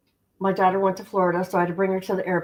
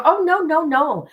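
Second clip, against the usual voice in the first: higher and louder, playful denial; emphatic no; 7 semitones higher, 4 dB louder than usual. Real, not acted. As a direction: shoot the idea down with a grin. Denies starting at a lower level.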